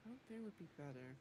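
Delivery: softly thinking aloud; ruminating to herself. Acted. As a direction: mull it over half to yourself, with long pauses. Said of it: "She thinks and ruminates to herself".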